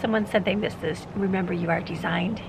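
usual speaking voice

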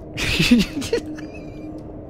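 high squealing laugh; helpless high laughter; cracking up. Real, not acted. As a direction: burst out laughing at your own bit. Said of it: "I cracked myself up".

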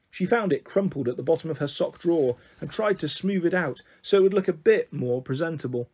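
usual speaking voice

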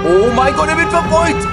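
high, loud alarmed cry; being chased. Real, not acted. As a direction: panic as someone is hunted. Watching character get chased.

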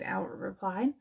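usual speaking voice

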